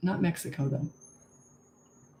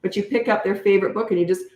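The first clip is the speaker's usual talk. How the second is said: ordinary delivery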